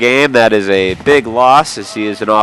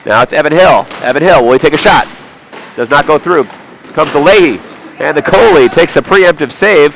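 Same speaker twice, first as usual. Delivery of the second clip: excited play-by-play; 5 semitones higher, 5 dB louder than usual. Real, not acted. Live hockey play-by-play.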